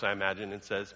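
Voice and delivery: ordinary talk, nothing marked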